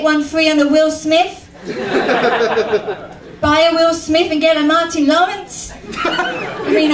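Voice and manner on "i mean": squeaky laughing aside. Real, not acted. Comic to audience, squeaky high laughing aside. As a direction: jump way up into a giggly squeak.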